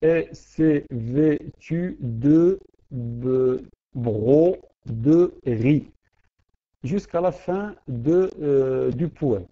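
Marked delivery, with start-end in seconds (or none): 0.0-5.9 very slow, syllable by syllable; teacher scanning the verse for the class; counting syllables aloud. Shown as a demonstration. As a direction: stretch the line out to count each syllable. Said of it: "la même chose".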